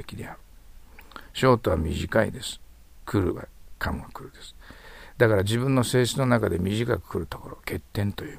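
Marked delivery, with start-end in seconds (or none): none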